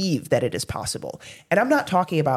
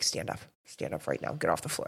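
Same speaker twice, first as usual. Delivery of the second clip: much softer, lower, firm command; quietly insist, like a parent in public; hushed stern parent; 10 semitones lower, 9 dB quieter than usual. Retold or imitated. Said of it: "they're like".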